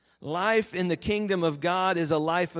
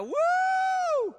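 a sudden whoop shot way up high and loud; joyful whoop; 6 dB louder than usual. Retold or imitated. The groom whooping as the couple are introduced, ecstatic. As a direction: let out a whoop of pure joy. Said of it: "Tanner let out a".

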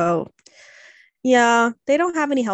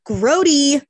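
grossed out; 5 semitones higher, 8 dB louder than usual. Retold or imitated. Vet reacting to worms.

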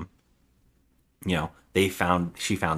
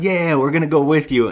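louder, higher eager agreement; retold eager cheer; 6 semitones higher, 5 dB louder than usual. Troops agreeing to jump on the boats, eager. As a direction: chime in as the keen troops.

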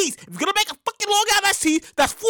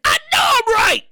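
louder, higher cry of being right; triumphant shout; 7 semitones higher, 4 dB louder than usual. Real, not acted. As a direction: shout it back, vindicated. Shouted reply to imagined listener.

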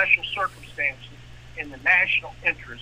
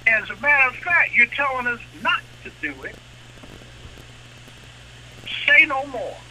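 much higher and louder, gleeful mock delight; eager bank voice; 8 semitones higher, 6 dB louder than usual. Bank cheering the waived rules in a high mock-gleeful voice. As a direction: play the greedy bank thrilled to be let off the hook. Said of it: "They say".

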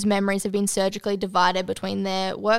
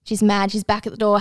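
ordinary delivery

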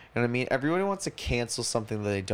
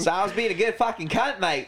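laughing, higher hammed-up Aussie voice; mock Australian accent; 8 semitones higher, 3 dB louder than usual. Slipping into the Australian bit again mid-story. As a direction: put on a cheeky Aussie bloke, giggling. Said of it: "I'm feeling it a little bit".

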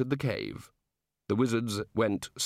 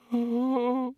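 high, wordless squeak; strangled little squeal; 13 semitones higher than usual. Acted. Senior wrangler aghast at the conversation, squeaking. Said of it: "squeaked the senior wrangler".

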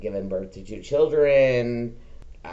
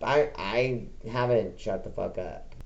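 ordinary talk

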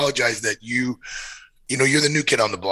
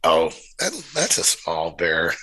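lower, slow offhand dismissal; offhand downplaying; 6 semitones lower, slower than usual. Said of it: "I'd say things like".

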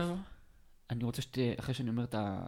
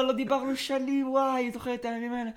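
much higher, loud gushing woman's voice; fake old friend voice; 13 semitones higher, 6 dB louder than usual. Prank caller posing as old friend Chedva, high and loud. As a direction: gush like a long-lost girlfriend, loud and high. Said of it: "במסווה של חדווה".

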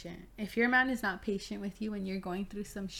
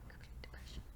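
whisper; 19 dB quieter than usual. Real, not acted. Whispered repeat of the phrase.

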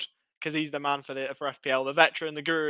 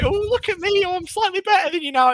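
much higher, taunting sing-song impression of a gloating mate; mocking rival voice; 3 dB louder than usual. Podcaster mocks how his rival will brag, high and taunting. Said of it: "In that voice as well".

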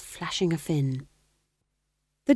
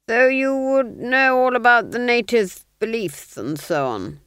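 higher, slow silky probing; sly Duke; 6 semitones higher, 6 dB louder than usual. Acted. Duke slyly probing the fool, slow and silky. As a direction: coax it out of him slowly.

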